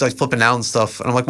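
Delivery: ordinary talk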